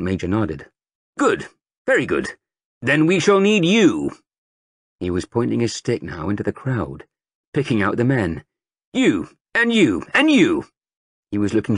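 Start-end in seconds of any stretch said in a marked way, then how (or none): 1.2-4.1 sergeant major. A narrator's reading. Sergeant major rousing the market crowd, booming. 8.9-10.6 slow, high, each you singled out; pointing out recruits. A narrator's reading. Sergeant major picking out men with his stick.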